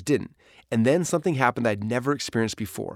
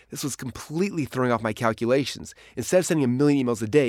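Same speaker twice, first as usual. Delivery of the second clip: ordinary talk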